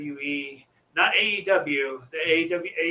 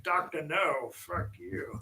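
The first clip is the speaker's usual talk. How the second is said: Sean Connery impression; 8 dB quieter, slower than usual. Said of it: "make like Sean Connery and say".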